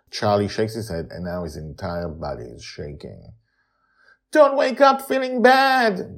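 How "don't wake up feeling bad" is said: put-on high girl's voice begging. A narrator's reading. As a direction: beg him like a scared little girl. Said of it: "Joy begs him".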